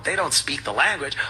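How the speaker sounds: ordinary delivery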